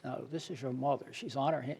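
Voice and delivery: ordinary talk, nothing marked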